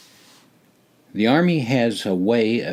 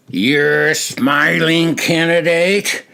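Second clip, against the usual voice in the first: loud, slow, drawn-out accusation; blaring; 6 semitones higher, 5 dB louder, slower than usual. A narrator's reading. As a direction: blare it into his face. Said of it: "blared into Nate's face".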